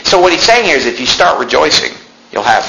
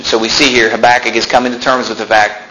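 usual speaking voice

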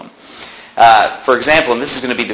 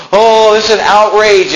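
higher and louder mock indignation; mocking outrage; 9 semitones higher, 4 dB louder than usual. Preacher mocking leaders' outrage for the congregation, higher and louder. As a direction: mimic a civil rights leader's indignant cry. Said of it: "they're crying, saying".

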